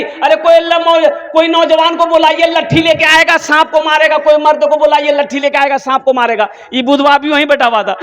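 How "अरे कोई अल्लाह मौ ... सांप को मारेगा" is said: hammed-up high shriek of scared women; imitating panicked women. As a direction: play the frightened women calling for a man. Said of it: "तो औरतें चिल्लाने लगी".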